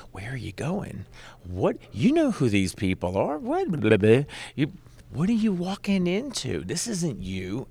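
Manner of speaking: nagging high-pitched voice on the shoulder; Satan. Retold or imitated. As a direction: pester with urgent, disbelieving questions, pitch leaping up. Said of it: "what Satan sounded like".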